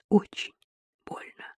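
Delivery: fading to a whisper on больно; faint echo. A narrator's reading. Tanya echoing her husband, a faint whisper. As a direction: barely breathe the words back. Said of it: "эхом откликнулась Татьяна".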